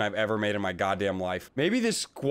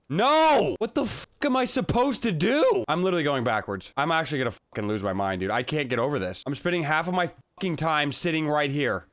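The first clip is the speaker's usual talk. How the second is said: normal talking voice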